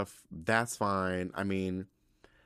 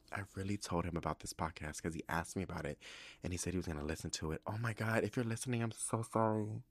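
quiet, real embarrassment; mortified confession; 2 semitones lower, 7 dB quieter, faster than usual. Real, not acted. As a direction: shrink into a soft apology. Mortified he may be listening.

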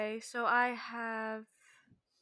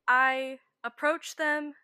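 ordinary talk, nothing marked

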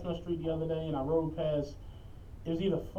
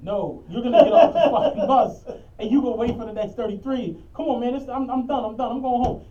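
retold yell, laughing; 4 semitones higher, 8 dB louder than usual. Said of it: "I'm like".

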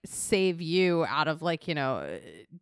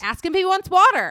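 bossy order; 12 semitones higher, 10 dB louder than usual. Retold or imitated. Casting office boss giving order.

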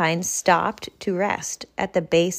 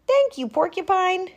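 higher happy thanks; grateful bear; 12 semitones higher than usual. Acted. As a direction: thank a friend gladly. Character voices.

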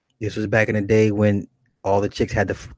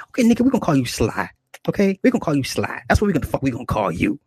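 bossy woman; 5 semitones higher, faster than usual. Retold or imitated. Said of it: "she was just mad gruff".

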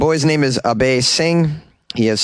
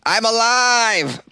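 drawn-out squeaky I'm alive; high, slow voice; 10 semitones higher, much slower than usual. Acted. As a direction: stretch it high. Mock weak claim.

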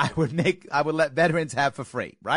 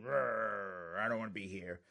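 sulky grumble; 7 semitones lower, 10 dB quieter, slower than usual. Retold or imitated. Sulky vets forced into class.

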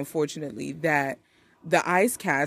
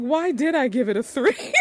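laughing at herself; 5 semitones higher than usual. Retold or imitated. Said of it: "I was like".

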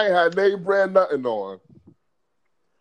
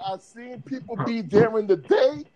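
savoring the quote; slower than usual. Retold or imitated.

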